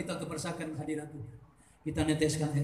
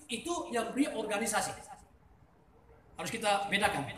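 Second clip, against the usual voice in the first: ordinary delivery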